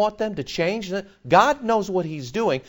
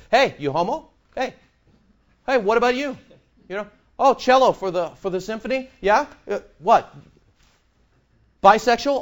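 higher, prying, hammed-up questioning; mock nosy coworker; 6 semitones higher than usual. Preacher mimicking union members quizzing him, mocking nosy voice. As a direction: poke fun at the busybody asking.

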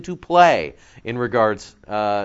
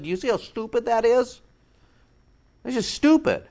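scornful; 10 semitones higher than usual. Real, not acted. Preacher scorning an objection.

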